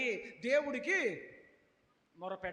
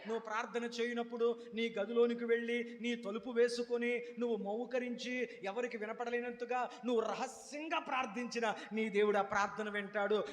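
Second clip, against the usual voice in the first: ordinary talk, nothing marked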